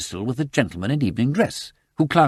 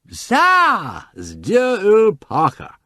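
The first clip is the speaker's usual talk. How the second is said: much higher, loud, slow tipsy cry; drunk announcement; 12 semitones higher, 7 dB louder, much slower than usual. Acted. Lord Peter tells Sugg about Parker, high and slow. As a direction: point it out in drunken delight.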